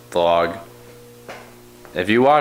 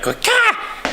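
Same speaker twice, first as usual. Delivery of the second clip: karate yell. Retold or imitated. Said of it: "I just went like".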